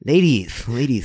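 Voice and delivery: lisping suave guy, falling tone; lightly put-on smooth ladies' man; smarmy lisped address; Doki Doki voice. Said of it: "the Doki Doki Literature Club voice".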